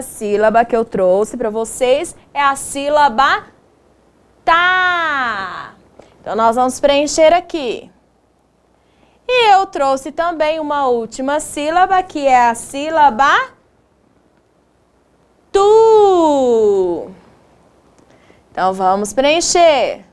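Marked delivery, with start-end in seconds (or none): 4.4-5.8 TÁ held long, falling in pitch; drawn-out syllable. Shown as a demonstration. Teacher showing a syllable. 15.5-17.1 TU held very long, gliding down; one long, falling "tu"; drawn-out syllable. Shown as a demonstration. Teacher showing a syllable.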